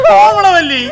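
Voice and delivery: loud, high cry of her name; dramatic protest. Acted. Husband stops wife's talk of dying, dramatic protest.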